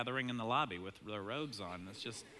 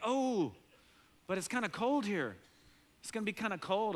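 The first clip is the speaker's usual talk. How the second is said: put-on worried kid; 7 semitones higher than usual. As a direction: fret like a small kid. Kid worries about cold.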